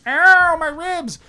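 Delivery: very high, whiny cry of a beaten thug; mock hurt henchman. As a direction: squeal it like a cartoon henchman in pain. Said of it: "lie on the ground going".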